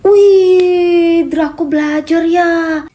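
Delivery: amazed exclamation. Acted. Mother amazed to find her son studying, loud. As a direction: gasp out loud at the sight.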